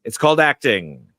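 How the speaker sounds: a hint of a grand stage actor, clipped and loud; John Gielgud. Quoting Gielgud to Hoffman, a touch of haughty stage voice. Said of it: "as John Gielgud said to Dustin Hoffman".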